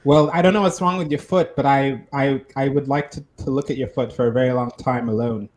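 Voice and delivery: low smug suitor voice; deep character voice. Acted.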